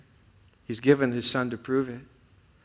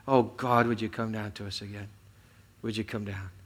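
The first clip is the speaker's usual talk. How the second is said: soft, low, intimate prayer; hushed prayer; 5 semitones lower, 7 dB quieter than usual. Real, not acted. Pastor praying for God to come down, soft and low. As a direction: drop to a near-hush and pray.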